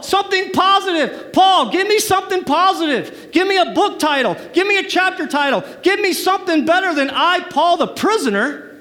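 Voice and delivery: rising mock demand, almost shouting; exasperated plea. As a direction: beg Paul for a better title. Pleading with Paul for better.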